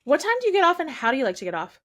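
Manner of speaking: high, loud eager question; hammed-up high come-on; high loud flirt. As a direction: ask like an overeager admirer. Acting the flirt role.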